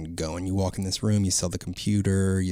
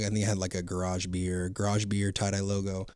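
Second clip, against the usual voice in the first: normal talking voice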